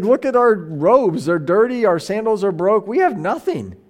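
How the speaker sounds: hammed-up exasperated insistence; mock exasperated protest. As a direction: protest as a penniless apostle. Acting out penniless apostles protesting.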